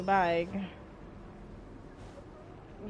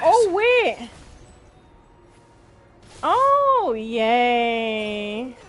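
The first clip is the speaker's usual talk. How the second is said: excited shout; 8 dB louder, much slower than usual. Real, not acted. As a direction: yell it out in delight. Sudden win in game.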